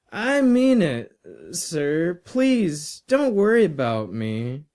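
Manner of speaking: high-pitched, insistent earnest student; pleading boy. Acted. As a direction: plead with sir, voice jumping up high. Boy pleads with teacher not to worry.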